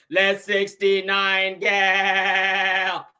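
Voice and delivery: slow, flat robot voice talking aggressively; robotic Martian. Retold or imitated. Host mocking a Martian robot voice, slow and robotic. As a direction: drag each word out like a bossy alien machine. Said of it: "Real robotic and shit".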